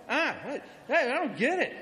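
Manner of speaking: high, loud, sputtering outburst; ranting neighbor. Retold or imitated. Neighbor keeps going after the sarcasm, ranting loudly. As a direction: sputter and yell in protest. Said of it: "He was just going".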